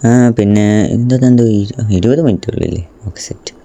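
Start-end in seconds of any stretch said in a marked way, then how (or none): 3.1-3.5 soft hushed whisper. Real, not acted. Gamer sneaking past raptors, whispering. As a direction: whisper so nothing hears. Sneaking dino near predators.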